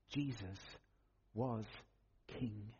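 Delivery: low, soft, a pause after each word; hushed solemn statement. Real, not acted. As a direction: let each word drop quietly and separately. Solemn repeat closing the point.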